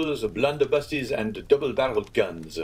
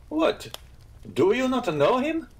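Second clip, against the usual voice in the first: very high, incredulous question; astonished; 4 dB louder than usual. Acted. Innkeeper amazed the guest never heard of the bandit, astonished. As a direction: voice leaps up in disbelief.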